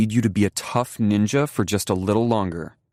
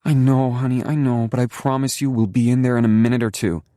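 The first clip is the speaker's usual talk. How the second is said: soothing; 8 semitones higher, 6 dB louder than usual. A narrator's reading. Mother soothes crying child.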